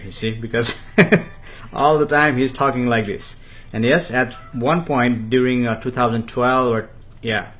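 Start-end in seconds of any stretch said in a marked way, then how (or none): 0.8-1.7 short, high giggle; brief burst of real laughter; quick high chuckle mid-story. Real, not acted. Laughs while telling family anecdote.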